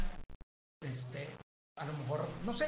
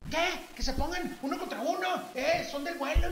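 high-pitched imitation of an outraged mom; mock a mother jumping in, voice way up; indignant mother; 11 semitones higher than usual. Other boy's mother jumping in to argue, indignant mock voice. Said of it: "Y en eso brinca mamá".

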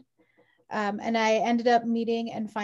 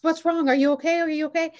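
worried husband; 6 semitones higher, 5 dB louder, faster than usual. Retold or imitated.

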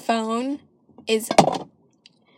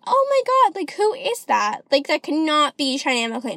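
surprised friends; 4 semitones higher than usual. Retold or imitated. Friends answering her phone, imitated high surprised gasp. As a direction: imitate friends gasping at the caller name. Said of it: "they'll be like".

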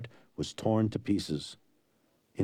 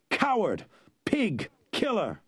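put-on boy shouting insults; 11 semitones higher, slower than usual. A narrator's reading. Young Inigo repeating his insults at the killer, shouting. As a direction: hammer each insult home. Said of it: "repeating his words".

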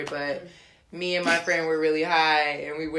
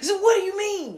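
mock innocent; 4 dB louder than usual. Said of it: "You be like".

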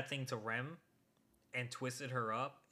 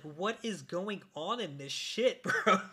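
higher and louder, slow, cracking into a laugh; exasperated laughing outburst; 7 semitones higher, 8 dB louder than usual. Retold or imitated. Fan ranting to friend about confusing episode, loud laughing disbelief. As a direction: throw up your hands and laugh at the chaos. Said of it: "I'm like".

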